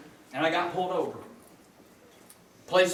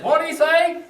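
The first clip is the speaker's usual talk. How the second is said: higher, louder mock deaf wife; hard-of-hearing wife asks husband, louder higher mock question; 9 semitones higher, 7 dB louder, slower than usual.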